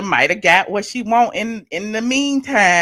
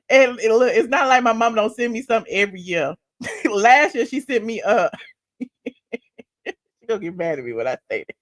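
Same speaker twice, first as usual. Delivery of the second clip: normal talking voice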